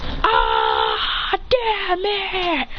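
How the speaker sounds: rough scream jumping up high; hammed-up outburst, starting rough and ending high; frustrated yell. Student bursting his chakra over the team, frustrated yell. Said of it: "Yelling, bursting his chakra".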